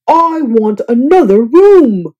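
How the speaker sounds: slow, loud, firm demand; fuming. A narrator's reading. Guest demanding new room, fuming slowly. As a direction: spell it out, fed up. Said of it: "Mister Snore fumed".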